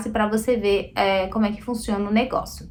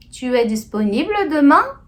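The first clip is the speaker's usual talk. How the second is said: higher question tune, rising on "demain"; tom de questão; 4 semitones higher, 4 dB louder than usual. Shown as a demonstration. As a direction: let the voice climb through to "demain". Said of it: "a gente põe tom de questão". (tom de pergunta, voz subindo em "demain")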